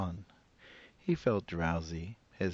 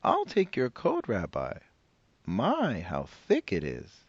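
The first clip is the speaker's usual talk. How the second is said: put-on high, bright old granny greeting a guest; old woman voice; 4 semitones higher, 4 dB louder than usual. Old woman welcoming the rabbi at her door, high and cheery. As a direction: play the cheerful old grandmother.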